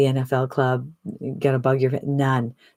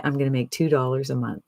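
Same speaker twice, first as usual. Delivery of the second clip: ordinary talk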